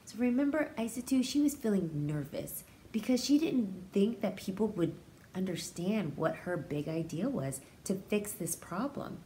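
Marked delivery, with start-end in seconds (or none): none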